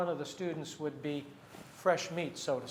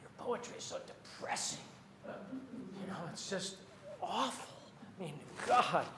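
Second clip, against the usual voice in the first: hushed mock complaint about someone's poetry; imitating unimpressed schoolmates; 2 semitones higher, 11 dB quieter than usual. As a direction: do the critics under your breath, voice lifting on "awful". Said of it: "even back to high school, people would say".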